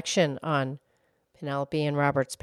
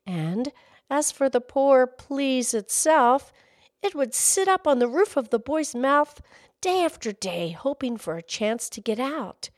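gentle read-aloud storyteller voice; story narration; 6 semitones higher than usual. A narrator's reading. Host reading a children's story aloud, storytelling. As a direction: read it like a bedtime story to kids.

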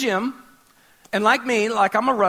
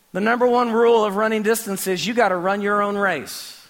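usual speaking voice

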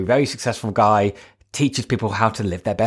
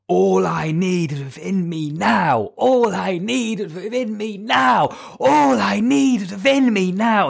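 slow, much higher chanted bellow, building; bellowed mantra; 9 semitones higher, slower than usual. Retold or imitated. Coach's exercise, bellowing the mantra around the room. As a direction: boom it out like an incantation.

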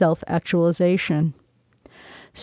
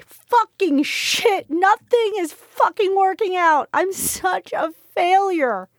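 high, drawn-out anguished wail; broken-hearted cry; 12 semitones higher than usual. A narrator's reading. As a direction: wail in despair, voice pitched far up. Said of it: "I screamed in a broken-hearted cry".

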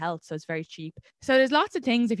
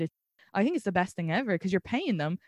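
normal talking voice

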